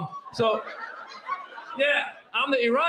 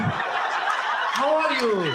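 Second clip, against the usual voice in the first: very drawn-out, drowsy Iranian greeting; slow Iranian talk; much slower than usual. Acted. Comedian mimics slow-talking Iranians greeting someone, drawled out. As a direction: stretch every word as if half asleep. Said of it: "they talk a lot slower".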